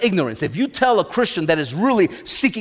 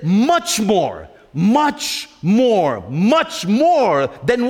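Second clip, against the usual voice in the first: slow, swelling much more; stretched out, insistent much more; building emphasis; much slower than usual. Real, not acted. Preacher reads with rising emphasis.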